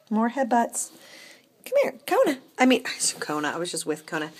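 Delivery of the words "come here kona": much higher, coaxing call of the name; pet-talk call. Acted. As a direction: call the kitty sweetly. High call to the cat.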